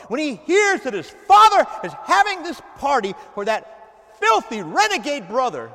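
loud, high bitter tirade; older son sneering at the renegade brother; resentful outburst. Acted. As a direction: spit it out like the jealous brother. Preacher voices angry older son.

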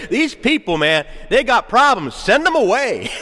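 high, emphatic brush-off; mock a church washing its hands of people; dismissive church member. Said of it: "No church should ever say".

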